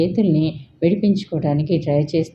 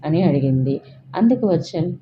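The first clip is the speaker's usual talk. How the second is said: usual speaking voice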